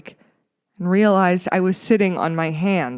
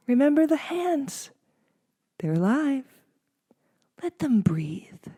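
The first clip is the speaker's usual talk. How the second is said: creepy teacher, fading to a breathy hush; spooky instructor trailing into a hiss; eerie mystical teacher; 7 semitones higher than usual. A narrator's reading. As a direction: make it uncanny, then let it die away. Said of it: "eerie teacher guidance".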